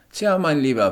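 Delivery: höher und lauter, bestimmter Sanitäter. Acted. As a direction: fordert den Patienten energisch auf liegen zu bleiben. Sanitäter spricht zum Erwachten. (Sanitäter beugt sich über den Patienten, höher und lauter)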